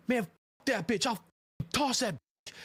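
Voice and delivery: angry thug voice. Retold or imitated. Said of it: "I hear somebody come in and go".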